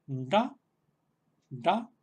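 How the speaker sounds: "da" voiced, tongue tip curled back; firm retroflex "da". Shown as a demonstration. Teacher showing the voiced version of "ta" for the class. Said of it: "la versión sonora de la ta".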